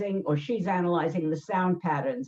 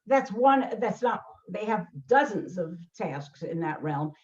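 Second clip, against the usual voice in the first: normal talking voice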